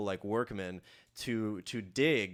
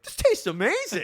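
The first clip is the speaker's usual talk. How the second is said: put-on very high, loud kid voice; excited commercial kids; 7 dB louder than usual. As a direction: squeal it like a child in a cereal ad. Kids cheering in imagined cereal commercial.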